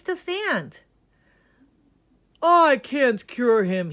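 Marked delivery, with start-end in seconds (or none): 2.4-3.9 loud, slow and high, giving up; loud slow band leader. Acted. Band leader gives up on Ned's cure, loud. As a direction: declare it slowly and loudly. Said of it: "he said".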